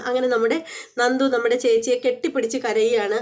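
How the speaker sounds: plain reading voice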